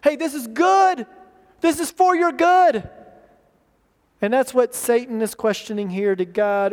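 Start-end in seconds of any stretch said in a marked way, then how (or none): 0.0-2.9 higher-pitched mock sales pitch; selling a lie. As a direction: pitch it as a great deal, voice lifted. Voicing the lie packaged as light.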